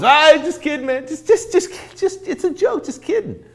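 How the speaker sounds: high, laughing just-kidding; back chuckle. Retold or imitated. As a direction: laugh off the lowball like a joke. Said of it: "he'd do a back chuckle".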